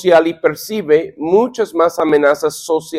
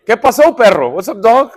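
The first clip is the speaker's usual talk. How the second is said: lightly acted rough male greeting, higher; brusque buddy greeting; 4 semitones higher than usual. Man greeting his buddy at work, acted brusque. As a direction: greet a workmate roughly, in play. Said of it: "Así hablamos nosotros los unos a los otros".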